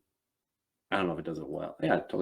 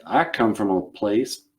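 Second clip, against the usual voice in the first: put-on Southern accent. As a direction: stand firm as a gentlemanly Southerner. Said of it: "I was like".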